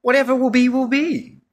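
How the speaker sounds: God shrugging it off in a high voice; high-pitched, breezy mock God; voicing God. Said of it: "He's like".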